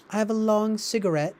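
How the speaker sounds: lightly affected mock-British voice; British accent. Host mocking Warhol's crowd acting British, put-on British accent. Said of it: "I do a British accent".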